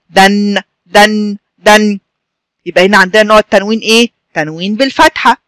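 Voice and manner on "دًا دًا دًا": short a in دن, then hum the n; fatha tanween. Shown as a demonstration. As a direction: say دن as "dan" with a held n. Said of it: "شايفين صوت النون ده؟". (نطق دن "دَنْ" بنون ساكنة)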